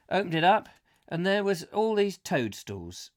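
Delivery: ordinary talk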